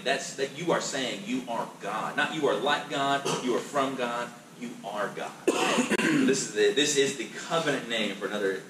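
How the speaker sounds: normal talking voice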